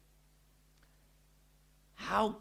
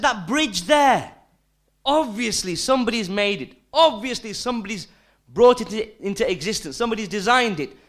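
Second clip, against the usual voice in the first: loud, raised-pitch retold argument; emphatic challenge; 6 semitones higher, 6 dB louder than usual. Preacher retelling his challenge to a doubter, emphatic and loud. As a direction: press the point home, high and forceful.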